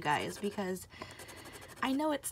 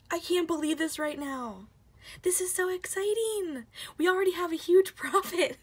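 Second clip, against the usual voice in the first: higher and louder, bubbling over; thrilled; 8 semitones higher, 7 dB louder than usual. Real, not acted. Celebrating the hundred dollar win to viewers, thrilled. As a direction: gush with joy.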